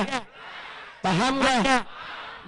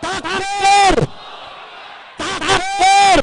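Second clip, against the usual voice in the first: loud, high takbir cry; rallying shout; 5 semitones higher, 8 dB louder, much slower than usual. Real, not acted. Orator calls the rally to answer with takbir, rallying shout. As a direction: shout it out to rouse the whole crowd.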